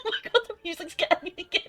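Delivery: normal talking voice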